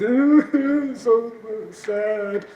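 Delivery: high, hammed-up wail of sadness; mock weeping. Man mocking his fear of floods of tears, exaggerated wailing. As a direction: pretend to blubber in floods of tears. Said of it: "that feeling meant floods of tears".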